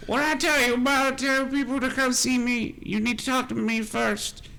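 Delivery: put-on high-pitched old woman telling off her son; scolding old mother. Mother scolding her son after smacking him, high-voiced. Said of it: "she just smacks him in the face".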